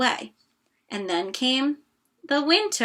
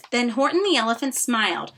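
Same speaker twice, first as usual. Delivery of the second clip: ordinary delivery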